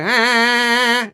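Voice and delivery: high slow twanged ah; buzzing twang. Shown as a demonstration. Coach demonstrating twang sound. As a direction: make the ah buzz. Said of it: "kind of like a buzzing".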